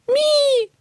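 a tiny beast's small calmed whimper; creature squeak. A narrator's reading.

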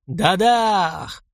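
voiced boom of a magic blast; explosion sound. A narrator's reading. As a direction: spit out a blast noise. Gunshot sound in fight.

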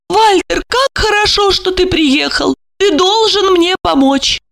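a helpless woman asking for help; high flustered female voice; helpless lady. A narrator's reading. Olga voiced by narrator.

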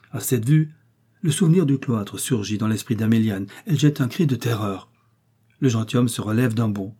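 ordinary delivery, nothing marked

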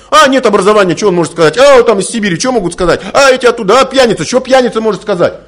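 loud, high scornful dismissal; mocking snobs. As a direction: ape the snobs who sneer at others. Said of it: "сводят на материальные качества". (громко, высоко, с презрительной насмешкой)

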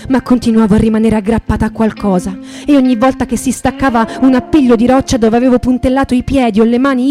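normal talking voice

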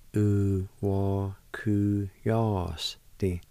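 "U, wa, ku, ya, s, di" said one syllable at a time with pauses. Shown as a demonstration. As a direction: sound out each syllable of "U, wa, ku, ya, s, di" separately. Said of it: "It's pronounced like this".

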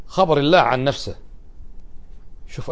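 ordinary delivery

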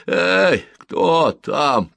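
loud, high call of a disturbed sleeper; sleepy mumbling resident. A narrator's reading. Sleepy resident to whoever is outside the window, displeased mumbled shout. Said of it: "промямлил сонный жилец".

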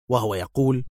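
usual speaking voice

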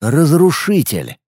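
slow, awed naming of "Разрушитель"; awed recognition. Acted. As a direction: let "Разрушитель" land slowly with awe. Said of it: "вспомнила".

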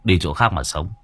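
low and blunt, handing over the cut; gruff gang boss. Acted. Gang leader hands his underling money, low and curt.

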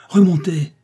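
high, loud cry; shouted command. A narrator's reading. Furious painter orders the visitor back upstairs, shouting. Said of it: "cria André".

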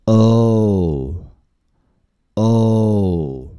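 "哦" drawn out, throat open, lips pushed forward; a long "哦" like a train through a tunnel. Shown as a demonstration. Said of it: "形成一个长长的隧道".

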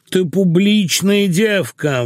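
high, slow whine; drawn-out whining. A narrator's reading. Husband reproaches his wife, whining slowly. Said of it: "тягуче заныл он".

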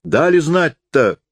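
high, slow, drawn-out call. A narrator's reading. Priest calls over about the funeral, calling out. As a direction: lightly call across, stretching the words. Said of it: "крикнул батюшка".

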